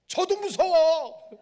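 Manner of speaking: slow, high, very loud cry; frightened wail. Retold or imitated. Pastor asked to stay with the body, high frightened wail. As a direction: wail it out in comic exaggerated fear. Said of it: "무섭대".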